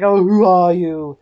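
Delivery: slow, drawn-out butler voice; haughty butler, stretching the words; stuffy butler. Acted. Butler questions the visitor at the door, stuffy. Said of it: "stuffy, uh, mustached butler".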